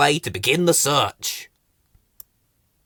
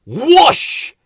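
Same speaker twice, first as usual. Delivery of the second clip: loud, high rushing whoosh; sudden swooping noise; 13 semitones higher, 9 dB louder than usual. A narrator's reading. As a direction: whoosh it like a speeding dash. Story noise before crash.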